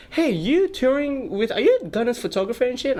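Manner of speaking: eager stranger. Retold or imitated. Stranger at show hailing him.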